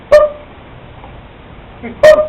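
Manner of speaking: very high electronic "boop"; short, piercing smoke-alarm chirp; imitated detector beep. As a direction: imitate a beep, far above speaking pitch. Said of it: "all I heard was this".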